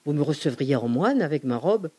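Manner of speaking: plain reading voice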